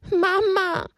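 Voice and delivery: high call of Mama; loud call Mama. Acted. Little donkey calling his mother as loud as he can. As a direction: call as far as you can. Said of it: "schrie Haberle wieder, so laut er konnte".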